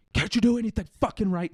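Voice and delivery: high-pitched, strained cursing rant; furious Putin outburst. Pretend. Putin swearing as the key snaps in the door, high furious yell. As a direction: explode at the broken key, voice shot up high. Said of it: "he's just gonna be like".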